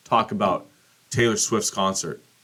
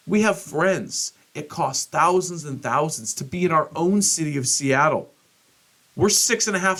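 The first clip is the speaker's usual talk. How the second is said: exasperated rant; 7 semitones higher than usual. Retold or imitated.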